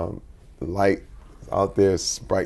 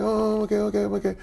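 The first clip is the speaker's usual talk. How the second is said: put-on higher, small boy's voice insisting he's fine; imitating scared son; 10 semitones higher, faster than usual. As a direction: play the six-year-old pretending he's okay. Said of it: "He's like".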